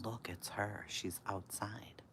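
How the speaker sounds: slow, hushed, breathy warning whisper; urgent whisper. Retold or imitated. Friend warning Carol that Maria is outside, whispered. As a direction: whisper it so Maria won't hear. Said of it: "So she's like".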